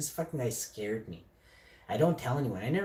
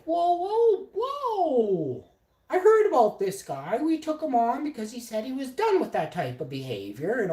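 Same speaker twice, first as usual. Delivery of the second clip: imitated alarmed owner; 12 semitones higher, 6 dB louder than usual. Owner hears complaint about head chef, high alarmed protest. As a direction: mimic a rich boss backpedalling in alarm.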